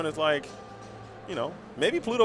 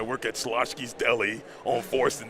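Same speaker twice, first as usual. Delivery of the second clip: put-on lower, Yonkers accent dog introducing himself; Yonkers accent Pluto; 6 semitones lower, 4 dB quieter than usual. Pluto introducing himself as a Yonkers deli worker, New York accent. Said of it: "he's from Yonkers".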